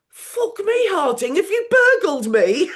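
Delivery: high, loud, laughing [name] voice; [name] impression. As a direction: play [name] amazed at his own decor. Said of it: "looks round and goes".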